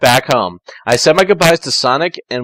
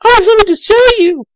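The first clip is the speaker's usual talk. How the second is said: put-on high girl voice for Tails; 6 dB louder, faster than usual. Tails calling Sonic in a high girlish voice. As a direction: sweetly announce yourself. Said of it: "I said".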